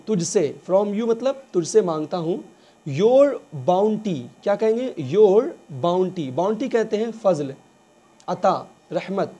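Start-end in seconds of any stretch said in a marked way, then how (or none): 2.9-4.2 very slow, each word drawn out; slow dictation. Shown as a demonstration. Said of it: "slow teaching read". 5.0-6.3 very slow, each word drawn out; slow dictation. Shown as a demonstration. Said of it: "slow teaching read".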